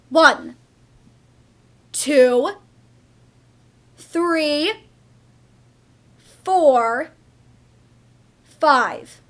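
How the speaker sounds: loud, deliberate count; stern countdown. Acted. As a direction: count it out as a threat. Mother counting down threat.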